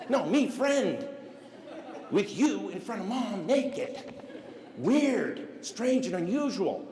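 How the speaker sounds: high, slow, word-by-word disbelief; mock flabbergasted. Johnny explaining to friend why this is weird, mock appalled. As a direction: spell it out like an appalled kid. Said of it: "And Johnny's like".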